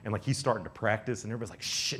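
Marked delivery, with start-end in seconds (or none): 1.6-2.0 sharp unvoiced group hush; shushing. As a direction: shush the over-excited one. Everybody shushes the excited boy.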